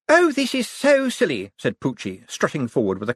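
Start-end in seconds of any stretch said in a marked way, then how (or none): 0.1-1.5 high, exasperated scoffing; scornful woman. A narrator's reading. Sister dismissing her brother's armed standoff, scornful.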